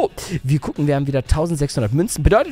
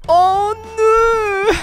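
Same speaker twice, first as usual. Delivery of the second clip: high whiny fake-sob lament; mock crying; 14 semitones higher, much slower than usual. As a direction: wail over the letdown like a drama queen. Portal entpuppt sich als Brunnen. (gespieltes weinerliches Jammern)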